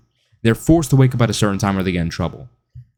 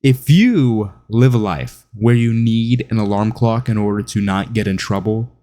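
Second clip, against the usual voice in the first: usual speaking voice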